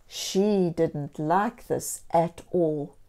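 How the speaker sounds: plain reading voice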